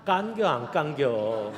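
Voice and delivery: slow, drawn-out Chungcheong dialect. Shown as a demonstration. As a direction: drawl "깐겨, 안 깐겨" the Chungcheong way with a laugh. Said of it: "충청도 말로 네 자로 줄일 수 있습니다". (느릿한 충청도 사투리)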